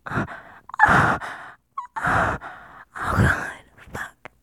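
forceful breathy gasps, voice withheld; breathless gasping. Pretend. Speaker trying not to scream, breathless whispered gasps. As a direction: gasp hard without letting the voice out. Intimate scene building to a peak.

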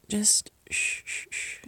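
soft whispered shushing; soothing shush. Acted. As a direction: hush him gently, barely a breath. Calming him.

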